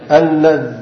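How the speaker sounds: اللذ stopped on the dhal, no vowel; vowelless final dhal. Shown as a demonstration. Teacher showing the third dialect form, اللذ with silent-vowel dhal. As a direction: end اللذ on a bare th sound, as in "this". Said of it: "مع إسكان الذال".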